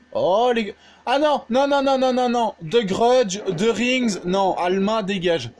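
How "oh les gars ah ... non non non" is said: panicked higher, louder string of no; frantic no no no, backing away; scared horror game streamer. Real, not acted. Ghost appears in horror map.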